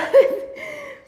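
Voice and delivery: high bursting laugh. Real, not acted. Host laughs to viewers after the dog interrupts, with a high burst of laughter.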